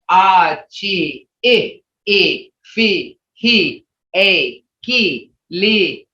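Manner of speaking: each sound separate, evenly paced; reciting letter sounds. Shown as a demonstration. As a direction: recite the sounds one by one, like a teacher's drill. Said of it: "See how they go?".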